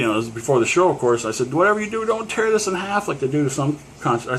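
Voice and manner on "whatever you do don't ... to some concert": much higher, acted insistence; urgent plea. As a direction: plead with the ticket taker in a raised pitch. Re-enacts pleading with ticket taker.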